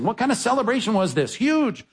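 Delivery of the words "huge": excited. Real, not acted. Answering his own question.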